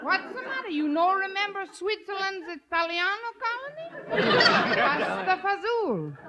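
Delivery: high, hammed-up Italian accent. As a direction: tease them in a mock Italian accent. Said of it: "sounds like Arthur Godfrey".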